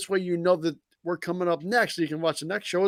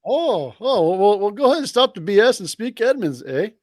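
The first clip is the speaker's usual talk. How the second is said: a hammed-up Canadian accent, a bit higher and louder; mock Canadian accent; 4 semitones higher, 5 dB louder than usual. Host asks for a Canadian, he plays it up with a Canadian accent. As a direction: put on a jokey Canadian voice with an eh. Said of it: "I wanna hear you do a Canadian".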